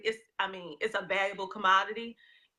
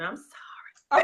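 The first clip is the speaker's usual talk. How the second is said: Southern drawl; 3 semitones higher, 5 dB louder, much slower than usual. Shown as a demonstration. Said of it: "Came out a little bit too Southern".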